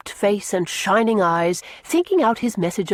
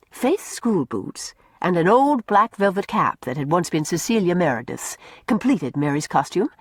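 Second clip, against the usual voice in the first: usual speaking voice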